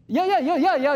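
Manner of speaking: high, loud rushed yeahs; eager yeahs. Acted. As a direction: gush the yeahs out like an over-eager friend. Mock reaction to unwanted raisins.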